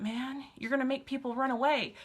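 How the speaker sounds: ordinary talk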